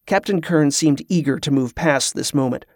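ordinary delivery, nothing marked

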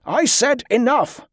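high, insistent call to halt; commanding shout. A narrator's reading. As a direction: call out firmly to stop the brawl. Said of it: "Donald called".

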